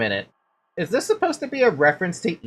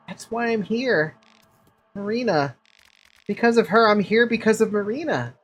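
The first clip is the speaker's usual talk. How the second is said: a lightly voiced pleading stranger, higher; 6 semitones higher than usual. Player voicing a visitor pleading to the priest, lightly pleading. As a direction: beg the priest to hear him out.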